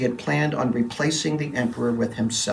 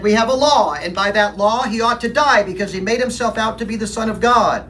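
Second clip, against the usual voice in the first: insistent demand; 7 semitones higher, 5 dB louder than usual. Acted. Priests answer Pilate insistently.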